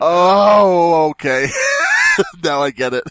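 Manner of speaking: the penny drops, out loud; retold shocked realization. Host retelling reading "Juggalette for life", loud shocked laugh. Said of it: "And I'm like".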